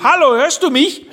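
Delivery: high, loud call down a bad line; calling loudly. Wife calling over a bad mobile line, loud call. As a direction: shout into the phone. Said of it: "und ruft".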